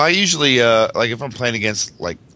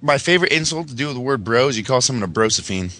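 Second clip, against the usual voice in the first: ordinary delivery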